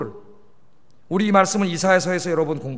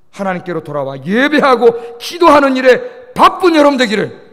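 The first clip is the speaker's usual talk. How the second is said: loud, raised-pitch pulpit exhortation; forceful preaching; 3 semitones higher, 6 dB louder than usual. Real, not acted. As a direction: drive each phrase home to the congregation. (힘찬 설교조로 권면)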